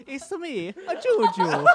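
high, loud cartoon Italian voice; hammed-up Italian character catchphrase; Mario. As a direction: chirp it like a video-game plumber. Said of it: "do the Mario impersonation".

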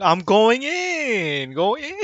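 high, drawn-out mock battle yell; screaming charge. Player screaming to the team while diving in, screaming charge. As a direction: stretch it out like a gamer charging in. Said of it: "just me screaming".

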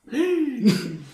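sharp, loud gasp of shock; loud surprised gasp. Real, not acted. Shocked reaction at the table.

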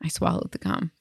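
quiet, sheepish little admission; soft confession. Retold or imitated. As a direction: confess it softly. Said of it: "And he was like".

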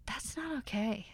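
soft unvoiced whisper, ashamed. Real, not acted. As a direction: whisper it as a confession. Confessing a mistake, whispered.

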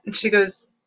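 usual speaking voice

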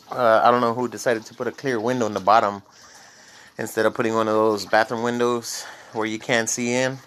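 normal talking voice

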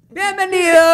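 hyped welcome shout. Acted. Show intro shout.

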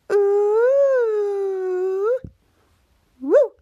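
long, high "ooh", then a short woo; teasing ooh. Acted. Friends hear Henry kissed Bianca, teasing ooh. Friends tease about the kiss.

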